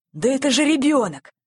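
astonished exclamation. A narrator's reading. Policeman discovering the culprit is a child, exclaiming. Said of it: "воскликнул полицейский".

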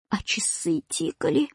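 plain reading voice

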